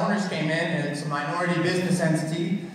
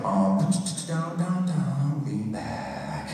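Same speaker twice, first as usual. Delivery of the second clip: hip-hop voice; 2 semitones lower, much slower than usual. Retold or imitated.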